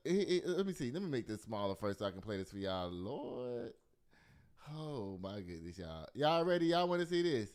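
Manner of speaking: usual speaking voice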